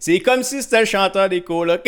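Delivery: loud, high, amused quoted outburst; amused mock exclamation. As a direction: belt it out as a story's punchline. Amused retelling of an exclamation, laughing around.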